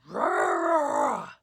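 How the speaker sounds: rumbling bear growl. Acted. Old Bear choosing jam, growling. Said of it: "Old Bear growled".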